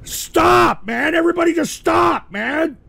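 high, loud shout of stop; angry yell. Real, not acted. Host yelling at his crew to stop the drops, angry shout. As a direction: shout them down to end it.

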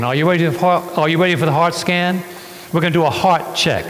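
normal talking voice

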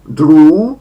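drew said loud with a lengthened U; long U in drew. Shown as a demonstration. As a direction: stretch the U in drew. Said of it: "wydłużone u". (głośne drew z długim u)

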